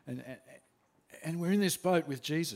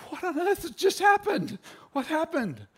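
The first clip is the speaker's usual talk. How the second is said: much higher, stunned outcry; astonished; 12 semitones higher, 4 dB louder than usual. Retold or imitated. Disciples watching the pigs drown, stunned high outcry. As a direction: gasp it out in disbelief. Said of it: "we're looking at this going".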